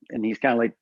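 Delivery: usual speaking voice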